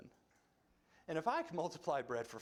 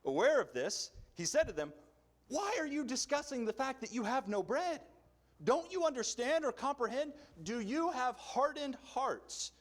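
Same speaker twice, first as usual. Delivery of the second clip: measured, raised reading voice; scripture reading; 7 semitones higher, 5 dB louder than usual. Acted. Pastor reading Mark to the church, measured and raised. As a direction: read the passage aloud to the congregation.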